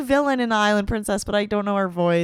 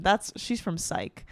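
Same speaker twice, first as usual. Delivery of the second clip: ordinary talk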